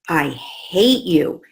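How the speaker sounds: slow, drawn-out mock I hate you; lightly hammed-up angry daughter; imitating child's angry outburst. Mother retelling daughter's outburst, slow mock anger. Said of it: "she looked at me and she said".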